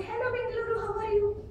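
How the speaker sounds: very high-pitched greeting imitation; Sneha. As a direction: mimic a high sing-out hello. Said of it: "Whereas Sneha will say".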